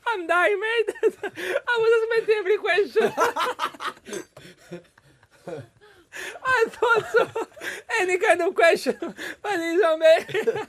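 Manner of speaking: high-pitched, breathless through laughter; words squeezed out between real laughs; laughing hard. Real, not acted. Cracking up at the question.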